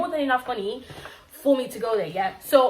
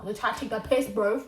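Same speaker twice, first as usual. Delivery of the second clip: usual speaking voice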